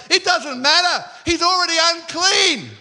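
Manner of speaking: high, loud triumphant shout; crowd shouting. Acted. Whole crowd answering the attendant, triumphant shout. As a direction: shout it out over the objection. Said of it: "the whole crowd now is like".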